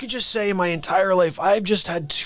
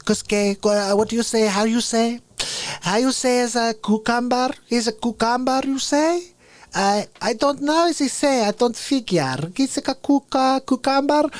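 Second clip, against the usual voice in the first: much higher, halting fake Spanish accent; 7 semitones higher than usual. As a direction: mangle English in a hammed-up Spanish accent. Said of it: "pretend you're from somewhere else".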